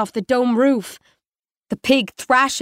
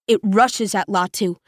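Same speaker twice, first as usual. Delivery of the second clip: plain reading voice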